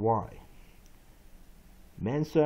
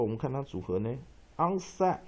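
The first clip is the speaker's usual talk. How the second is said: normal talking voice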